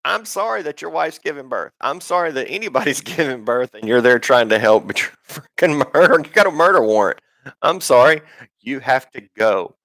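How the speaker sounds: mock apologetic officer. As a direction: play a lightly voiced fake-sorry agent.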